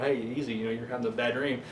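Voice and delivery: soothing. Retold or imitated. Frank soothing Jim from a nightmare.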